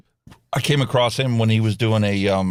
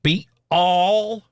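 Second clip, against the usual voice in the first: Southern drawl; 6 semitones higher, much slower than usual. Retold or imitated.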